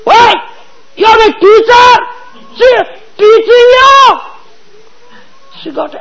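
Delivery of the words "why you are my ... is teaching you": high, loud indignant shout; angry retort. Retold or imitated. Mother-in-law angrily shouting about her daughter-in-law, indignant. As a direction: snap back in anger. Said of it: "She gets angry".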